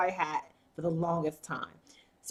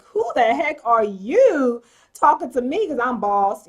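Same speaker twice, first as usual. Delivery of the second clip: louder, slowed, affronted; indignant boss; 3 semitones higher, 7 dB louder than usual. Retold or imitated. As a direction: bristle back at the man who insulted him. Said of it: "he's like".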